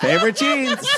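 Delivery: high-pitched indignant repeat; retold pissed-off outrage. Storyteller lamenting his ripped favorite jeans, pissed-off outrage. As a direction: push the mock outrage way up high. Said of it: "this is the moment at which I get pissed".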